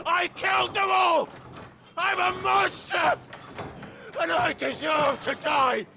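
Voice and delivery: high, hysterical screamed confession; anguished screaming. Acted. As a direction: scream it in despair, begging for death. Screamed confession, begging to die.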